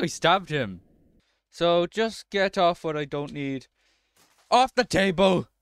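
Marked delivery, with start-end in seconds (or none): 4.5-5.5 loud, higher yell; frustrated shout; shouted outburst. Real, not acted. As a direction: shout it as you shove things away. Clearing the game table in frustration.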